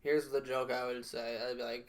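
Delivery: usual speaking voice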